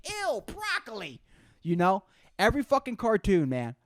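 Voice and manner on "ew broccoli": put-on disgusted kid. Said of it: "depict kids being like".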